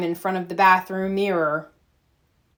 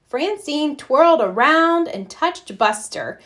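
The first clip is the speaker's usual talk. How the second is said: usual speaking voice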